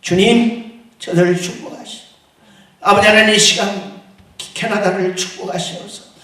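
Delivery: normal talking voice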